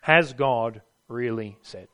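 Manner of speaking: slow, low whispering-tempter question; sly devil drawing out has God really said; tempter's voice. Acted. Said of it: "he comes to us ... and asks us a question".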